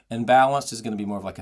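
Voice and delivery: ordinary delivery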